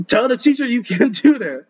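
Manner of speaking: talking through a laugh. Real, not acted. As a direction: breaks into laughter mid-sentence. Laughing while reading a line.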